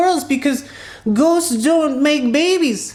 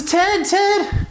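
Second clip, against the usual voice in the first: higher, mildly urgent name call; lightly acted anxious hail; 5 semitones higher than usual. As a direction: run up calling a friend's name. Ned runs up to Ted.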